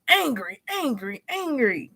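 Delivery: high, falling mock yell, word by word; punchy high-pitched tantrum imitation; imitating child's yelling. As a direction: mimic a kid's angry outburst without real volume. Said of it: "He was just yelling".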